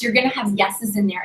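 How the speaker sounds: ordinary talk, nothing marked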